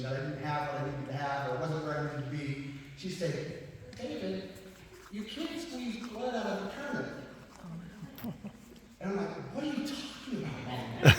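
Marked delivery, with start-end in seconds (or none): 4.0-7.1 mother's voice. Retold or imitated. Voices his mother's stock saying. 9.5-11.1 baffled son. Retold or imitated. His baffled reply to mother.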